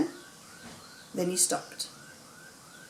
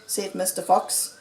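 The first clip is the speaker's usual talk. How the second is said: ordinary delivery, nothing marked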